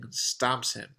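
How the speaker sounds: ordinary delivery, nothing marked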